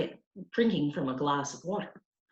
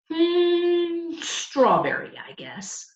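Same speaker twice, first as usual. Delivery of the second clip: put-on thoughtful boy; 11 semitones higher, much slower than usual. A narrator's reading. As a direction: hum while deciding, then settle. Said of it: "he answered".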